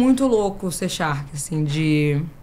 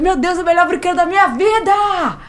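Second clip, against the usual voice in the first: euphoric; 10 semitones higher than usual. Retold or imitated. Saindo do brinquedo, eufórica.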